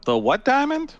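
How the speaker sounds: surprised. Real, not acted. Incredulous at game text.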